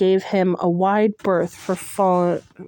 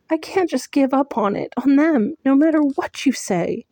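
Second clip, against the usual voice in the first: higher, strained and earnest; pained insistence; 7 semitones higher than usual. Acted. Heroine insisting to Tamlin, higher and strained. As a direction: hold on to them, almost pleading.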